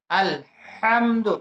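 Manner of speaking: "আলহামদু" very slowly, with a breathy throat h; throat ha drill. Shown as a demonstration. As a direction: split "আলহামদু" after আল, then draw out হাম from mid-throat. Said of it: "এই হরফটা উচ্চারণ করলে". (টেনে টেনে, গলার মাঝখান থেকে হা দিয়ে "আলহামদু")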